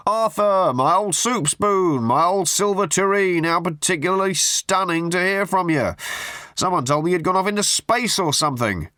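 butter him up with absurd pet names; gushing hack journalist. A narrator's reading. Tabloid journalist gushing down the phone at Arthur.

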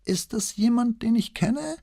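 put-on high old man's innocent question; old notary playing clueless; puzzled old man. A narrator's reading. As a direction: ask high-voiced, feigning ignorance.

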